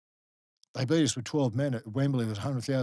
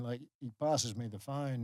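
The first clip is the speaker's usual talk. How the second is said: ordinary delivery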